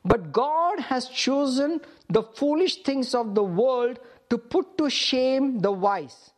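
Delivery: slow, deliberate scripture reading. Real, not acted. Preacher reads the verse for listeners to follow, very slowly. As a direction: stretch the key words, pausing between phrases. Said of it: "I'm gonna read it very slowly".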